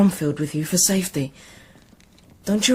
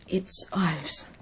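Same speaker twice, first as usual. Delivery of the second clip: whisper; 3 dB quieter, slower than usual. Acted. Boy whispering to confused monk.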